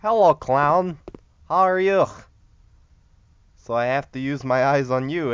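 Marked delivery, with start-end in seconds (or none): 0.0-2.1 high, loud hello to a clown; loud mock greeting. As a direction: greet the clown loudly in a raised pitch.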